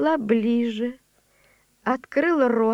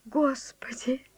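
whisper; 8 dB quieter than usual. Acted. Awed old servant prays.

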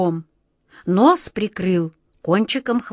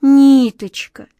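put-on higher, guilty little girl's plea; guilty child; 6 semitones higher, 8 dB louder, slower than usual. A narrator's reading. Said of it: "виновато сказала Глазастик". (виновато, детским голосом)

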